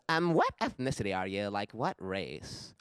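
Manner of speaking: lightly voiced nosy girl; imitating clueless chick. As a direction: put on a prying girl's voice a little. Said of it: "looks at me and goes".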